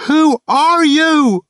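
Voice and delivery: high, loud, drawn-out scream into the dark; screamed question. A narrator's reading. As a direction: scream it at the shadowy figure. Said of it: "suddenly screamed at it".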